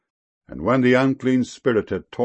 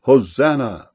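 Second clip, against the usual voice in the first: understated, louder cry of praise; crowd's acclamation; 2 semitones higher, 5 dB louder than usual. A narrator's reading. Crowd with palm branches greeting him, crying out with mild force. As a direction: lift the word as a measured acclamation. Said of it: "went forth to meet him, and cried".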